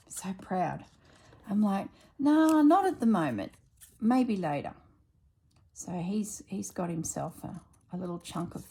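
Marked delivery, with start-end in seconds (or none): 2.2-4.7 higher, louder no-thanks; brushing off the bitter chocolate offer, loudly; firm refusal. Retold or imitated. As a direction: turn the offer down loud and bright.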